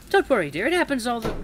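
put-on high, reassuring old lady voice; sweet granny. A narrator's reading. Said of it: "soft and sweet as always".

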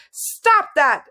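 high, urgent order; sharp order. A narrator's reading. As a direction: call out to stop him. Girl orders wizard to stop.

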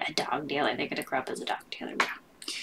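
fast, breathless whisper to herself; whispered aside. Acted. A joking aside to the viewers, whispered fast. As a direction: lean in and whisper the joke quickly.

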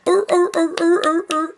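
loud, high barking arr arr of a male sea lion; imitated sea lion barking. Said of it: "he starts barking at her".

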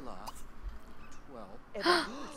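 long, soft gasp of shock; drawn-out shocked gasp. Real, not acted. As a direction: suck in breath, scandalized. Shocked at character's behavior in game.

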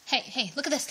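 ordinary talk, nothing marked

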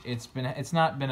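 ordinary delivery

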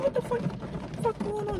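high, soft startled murmur; hushed surprise. Retold or imitated. Eavesdropping outside the break room, hushed high surprise.

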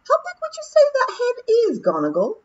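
very high squeaky Scottish pixie; a wee Feegle chatting calmly to his mate; Rob's pixie voice. Acted. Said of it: "said Rob Anybody".

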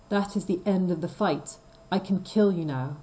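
usual speaking voice

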